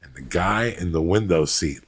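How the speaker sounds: usual speaking voice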